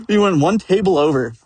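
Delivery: ordinary talk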